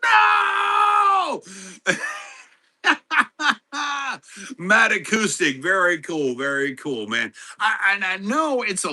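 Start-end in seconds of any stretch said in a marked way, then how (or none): 0.0-1.4 sudden high, loud cry of dismay; despairing shout. Retold or imitated. Streamer reliving his phone dying, shouting in dismay. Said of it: "I'm like". 2.8-4.5 a burst of high laughter. Real, not acted. Streamer laughing at his own retold yell, high laugh.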